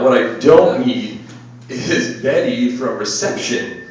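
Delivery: ordinary talk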